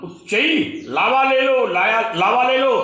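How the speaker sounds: imitating a street seller calling out; street vendor's call. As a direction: shout your wares down the lane. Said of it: "उच्च स्वर में जब वो कहने लगा".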